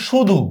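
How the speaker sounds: শুধু opening on "sh"; sh sound. Shown as a demonstration. Teacher gives students another example, শুধু with "sh". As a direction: say শুধু as "shudhu". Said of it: "উচ্চারণও করতেছি তালব্য স্বর মতো".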